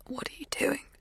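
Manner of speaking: a small, high, hushed question. A narrator's reading. Narrator's younger self, trapped in bed, asks in a small voice. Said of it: "My voice is too small".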